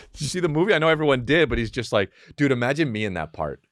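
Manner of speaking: ordinary talk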